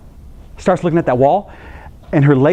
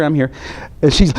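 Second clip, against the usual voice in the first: ordinary talk, nothing marked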